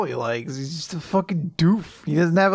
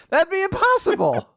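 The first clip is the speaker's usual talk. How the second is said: high, dismayed comic outcry; mock panic; 3 dB louder than usual. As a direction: exclaim it in hammed-up despair. Acting the boy's panicked objection.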